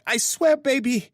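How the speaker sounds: high-pitched woman crying out a plea; rising plea. Acted. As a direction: push the plea up to a cry. Grace pleading in bed.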